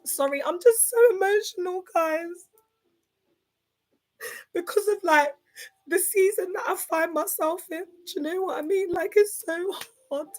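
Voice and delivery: fighting back tears on the words; moved to tears by a viewer's compliment; real tearful. Real, not acted. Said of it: "you're gonna make me cry".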